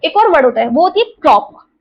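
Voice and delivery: normal talking voice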